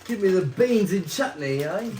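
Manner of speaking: hammed-up British bloke; mock British accent. Brother joining in mock British accent. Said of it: "British word".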